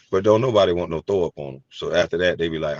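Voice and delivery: ordinary delivery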